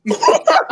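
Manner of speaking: loud, high cackle of a laugh; real loud laughing burst. Real, not acted. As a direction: crack up loudly. Laughing after "And I did.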